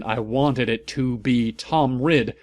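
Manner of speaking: ordinary delivery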